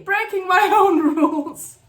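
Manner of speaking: loud, high laugh through the words; tearful laughing. Real, not acted. Writer breaking her own no-crying rule, tearful laughing outburst.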